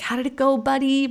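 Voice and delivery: high-pitched, eager imitation of dad; hopeful dad's bright question, lifted high; excited dad. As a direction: voice an excited father checking in, pitched well up. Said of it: "My dad all excited".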